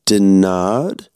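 a cold villain toying with his prisoner; menacing German officer. Acted. Officer makes a proposition.

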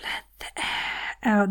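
airy, voiceless exhale; soft whispered out-breath; deflating. Shown as a demonstration. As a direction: let the words leak out on a sigh. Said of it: "Someone's let all the air out".

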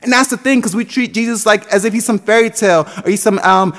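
emphatic pulpit delivery; preaching at full force. Acted. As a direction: preach it with conviction, punching key words.

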